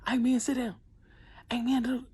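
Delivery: high, loud stern coach yell; mock mean yelling. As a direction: act out a mean coach shouting. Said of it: "If it's mean yelling, like".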